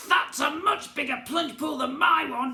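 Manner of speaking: high, loud, greedy goblin cry; excited goblin cry. A narrator's reading. Goblin prince seeing the sea, high excited cry. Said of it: "he cried".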